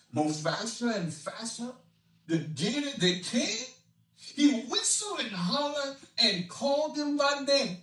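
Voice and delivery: rollicking Cajun rhyme, rising in pitch; Cajun dialect reading. Acted. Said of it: "an expert on Cajun dialect".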